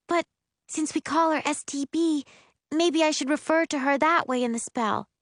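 put-on high-pitched girl thinking aloud; earnest, fussy sister; young spellcaster deliberating. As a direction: reason it out in a high voice.

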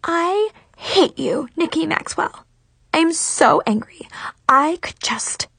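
high seething fury, halting phrases; Mackenzie. A narrator's reading. Rival telling Nikki she hates her, seething and high. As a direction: seethe towards a breaking point. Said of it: "she shrieked".

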